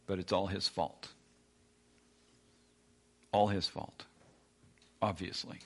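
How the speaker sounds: dry sarcastic blame; deadpan mock blame. Shown as a demonstration. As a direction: say it with an eye-roll. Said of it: "I'm being sarcastic".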